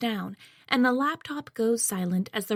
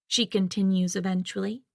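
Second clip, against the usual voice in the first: ordinary delivery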